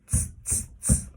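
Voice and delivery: three sharp, forceful "tss" hisses; monkeys' tutting. A narrator's reading. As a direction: mimic cross monkeys with strong tsks. Monkeys mimic the stamping peddler.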